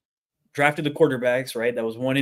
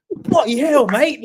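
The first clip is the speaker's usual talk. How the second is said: higher, louder mock-British exclamation; pitched-up imitation British astonishment; British accent; 10 semitones higher, 6 dB louder, slower than usual. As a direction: play the amazed British bloke. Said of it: "heard you do a British accent".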